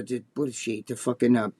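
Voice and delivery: ordinary delivery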